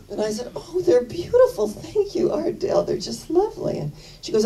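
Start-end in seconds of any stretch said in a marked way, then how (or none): 0.6-3.8 much higher, breathy-light gushing thanks; thanking a friend for little pear earrings, gushing high; gushing delight. Retold or imitated. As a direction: coo over the gift like it's the loveliest thing.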